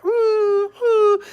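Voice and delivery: very high squeaky dog whimper; dog squeal. Retold or imitated. Owner imitating his dog's squeal for viewers, very high pitched. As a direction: squeal like a puppy begging for a treat. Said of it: "they'll be like a".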